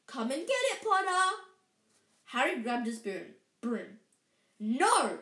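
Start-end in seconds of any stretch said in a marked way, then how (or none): 0.1-1.4 high, loud taunting call. A narrator's reading. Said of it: "he called". 4.6-5.2 loud cry of objection; shout. A narrator's reading. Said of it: "shouted Hermione Granger".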